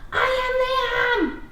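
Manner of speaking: high, loud and slow excited shout; excited yell. Shown as a demonstration. Teacher modelling an exclamation point, excited yell. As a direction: shout your name like big news. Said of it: "say it excited or yelling".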